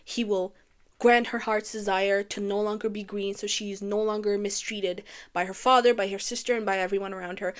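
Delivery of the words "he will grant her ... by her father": usual speaking voice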